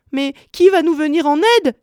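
high, pleading wail; distressed cry. A narrator's reading. Weeping Gretel begging for someone to help, high distressed cry. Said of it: "s'écriait-elle".

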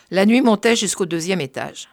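ordinary delivery, nothing marked

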